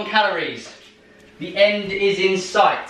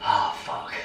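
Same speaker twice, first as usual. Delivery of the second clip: breathless whisper; 8 dB quieter than usual. Real, not acted. Exhausted mid-set curse.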